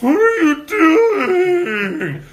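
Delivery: high, loud, drawn-out panicked shriek; mock alarmed shriek. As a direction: imitate the scared driver, hammed up. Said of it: "They're like".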